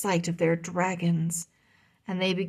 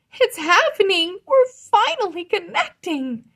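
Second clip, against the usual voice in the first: high, loud joyful cry; thrilled shout; 12 semitones higher, 9 dB louder than usual. Acted. Rory connecting with Vulcan, loud joyful shout. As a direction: shout out the breakthrough in delight.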